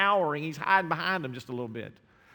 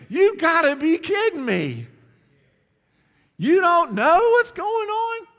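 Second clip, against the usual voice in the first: much higher, louder, slow disbelieving cry; incredulous outburst; 13 semitones higher, 10 dB louder than usual. Retold or imitated. Preacher voicing his own disbelief at the congregation, loud. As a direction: let the disbelief burst out. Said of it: "And I'm thinking".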